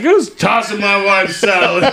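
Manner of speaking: high, slow indignant demand; mock outraged dad. Dad confronting a stranger on his son's game, mock outrage. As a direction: demand it in hammed-up disbelief. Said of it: "I stopped and I was like".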